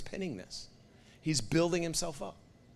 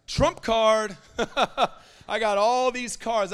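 ordinary delivery

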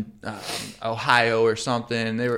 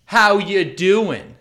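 higher, louder, slow showman hello; mock comedian greeting; 8 semitones higher, 6 dB louder, much slower than usual. Mocking a comedian, he does a showy crowd greeting. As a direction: ham up the emcee. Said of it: "They said".

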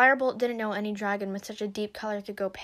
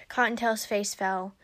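usual speaking voice

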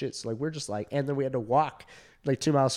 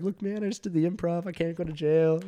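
high-pitched, whiny mock plea; near-tears pleading; 8 semitones higher than usual. Comic retelling of begging police officers not to jail him, mock near-tears pleading. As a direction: imitate yourself begging, almost crying. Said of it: "I started almost crying".

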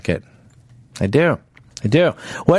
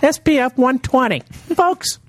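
much higher, hammed-up club comedian delivery; mock stand-up comic; 9 semitones higher than usual. Host telling sunscreen bit to imagined crowd, hammed-up comic. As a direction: work the crowd like a cheesy comic.

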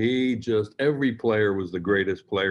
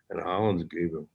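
ordinary delivery

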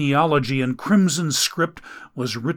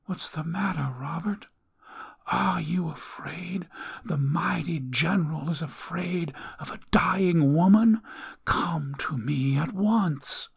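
higher, hushed, mocking whisper; taunting dying woman; 5 semitones higher, 4 dB quieter than usual. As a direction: tease him from a deathbed.

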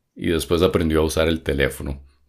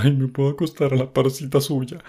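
high, small imitated kid voice; pleading little child; 8 semitones higher than usual. Scared child asking mother to share her bed, pleading. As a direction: plead like a child, much higher.

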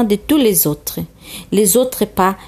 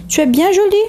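petit prince admiratif; 10 semitones higher, faster than usual. Acted.

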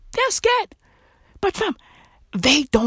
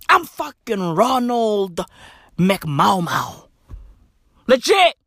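normal talking voice

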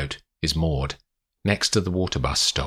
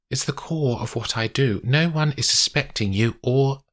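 lightly voiced lawyer, higher at the start; composed lawyer; 8 semitones higher than usual. Olivia tells Paddy what the letter appears to be, composed. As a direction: give a measured legal assessment.

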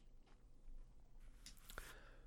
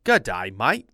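rising Australian accent; 7 dB louder than usual. Shown as a demonstration. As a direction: push the pitch up on "mate" in Australian. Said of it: "an inflection that goes up".

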